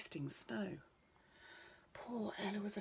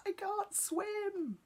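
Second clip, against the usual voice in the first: very high and slow, drawn out in panic; panicked cry; 3 dB louder, much slower than usual. Acted. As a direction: wail it while splashing in the river. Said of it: "he cried".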